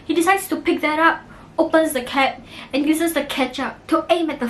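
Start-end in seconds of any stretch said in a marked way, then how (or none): none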